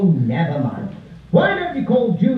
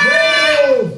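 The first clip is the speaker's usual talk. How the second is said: loud no; 6 dB louder than usual. Acted. Clown's loud refusal.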